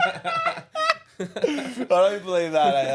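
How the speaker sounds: high, slow laughing protest; laughing disbelief. Real, not acted. Friend hearing the ranking, laughing in disbelief.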